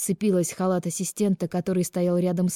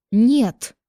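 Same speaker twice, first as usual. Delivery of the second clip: high, anguished shout; loud yell; 6 semitones higher, 8 dB louder than usual. A narrator's reading. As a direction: yell it out in despair. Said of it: "заорала Минякина в голос и разрыдалась". (отчаянный крик во весь голос)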